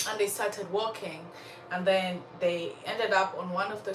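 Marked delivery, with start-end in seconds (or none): none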